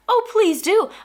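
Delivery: high, loud mock invitation; retold sarcastic comeback; sarcastic dare. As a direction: dare her to do it. Sarcastic reply to a threat.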